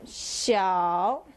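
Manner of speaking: "xiao" dipping low, then rising; third tone. Shown as a demonstration. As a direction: draw "xiao" down and back up. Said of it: "third accent".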